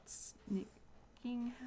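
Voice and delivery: sneaky whisper. Pretend. Sneaking in game.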